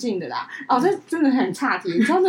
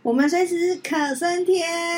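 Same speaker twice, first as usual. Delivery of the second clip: slow, drawn-out on-air reply; radio host voice; 6 semitones higher, much slower than usual. Acted. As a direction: play along like a radio co-host, slowly. Answering the radio-style opener.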